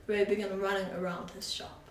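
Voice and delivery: ordinary delivery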